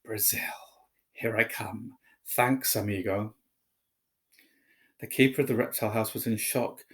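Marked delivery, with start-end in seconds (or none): 0.0-3.3 a hint of a soft snake hiss; low hissing snake. A narrator's reading. Snake thanking Harry as it slides past, lightly hissed. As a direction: breathe it like the escaping snake. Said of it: "a low, hissing voice said".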